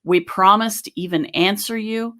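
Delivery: measured, scripted sign-off; composed end-of-show announcement; podcast outro read. Acted. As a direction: read the closing announcement evenly and clearly.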